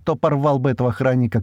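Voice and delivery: ordinary delivery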